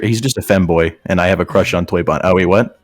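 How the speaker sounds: normal talking voice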